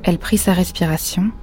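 plain reading voice

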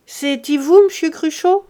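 loud, high call through the door. Acted. Servant calls through the grille to visitors, loud high call.

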